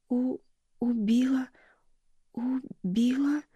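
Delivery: медленный высокий беззвучный шёпот; выдохни шёпотом, заикаясь; шёпот в шоке. Acted. Энди в шоке шепчет. (дочь в шоке шепчет медленно и выше)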